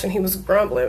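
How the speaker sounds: usual speaking voice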